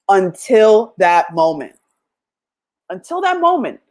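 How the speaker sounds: ordinary talk, nothing marked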